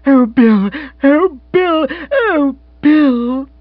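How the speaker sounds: put-on high, loud, emotional outburst as a young woman; breathless delight, rising with each repeat; joyful cry. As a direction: cry out swooning with joy. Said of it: "she cried".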